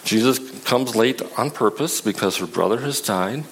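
usual speaking voice

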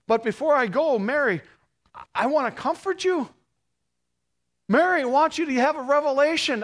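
Jesus to Mary. Acted. Risen Jesus urging Mary to carry the message, high and urgent. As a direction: plead with her to go and tell.

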